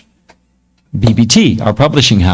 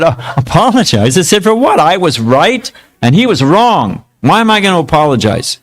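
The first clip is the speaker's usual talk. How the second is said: indignant disbelief; 6 semitones higher than usual. Retold or imitated. Young self arguing with swami.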